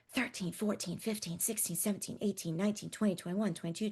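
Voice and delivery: counting under breath, softly; whisper counting. Shown as a demonstration. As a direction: whisper the numbers to yourself. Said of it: "we can whisper count".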